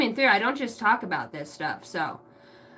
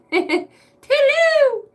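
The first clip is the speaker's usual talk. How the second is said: very high, drawn-out sing-song goodbye; mock dainty toodle-oo through laughter; high, slow singsong toodaloo; silly farewell; 14 semitones higher, 5 dB louder, much slower than usual.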